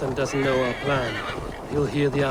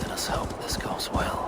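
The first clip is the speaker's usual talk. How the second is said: a hushed wish, almost to yourself; whispered hope; 4 dB quieter than usual. Acted. Eivor murmuring a hope as the army gathers, whispering. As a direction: breathe it out as a whisper. Quiet hope before the battle.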